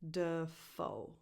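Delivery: "Dafoe" drawn out in two parts, duh... FOE; careful, stretched "Dafoe"; syllable demonstration. Shown as a demonstration. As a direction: separate the syllables of "Dafoe", stress on the second. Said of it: "So the first syllable is".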